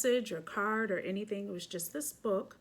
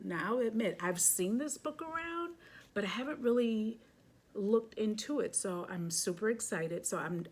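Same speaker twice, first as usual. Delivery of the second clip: usual speaking voice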